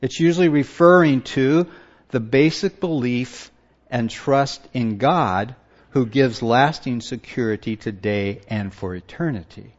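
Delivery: ordinary talk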